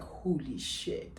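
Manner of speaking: low, soft, slow disbelief; hushed shock. Retold or imitated. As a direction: murmur it slowly, stunned. Stunned reaction to seeing the kiss.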